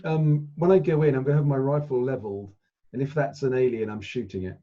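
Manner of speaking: ordinary talk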